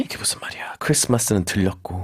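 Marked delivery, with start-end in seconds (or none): 0.0-0.7 confused whisper. Acted. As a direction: whisper it puzzled. Confused listener aside.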